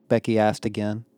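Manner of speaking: ordinary delivery, nothing marked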